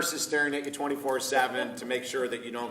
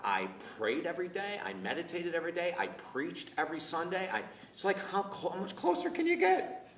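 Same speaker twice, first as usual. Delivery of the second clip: incredulous protest; 6 semitones higher than usual. Retold or imitated. Retelling his baffled inner protest.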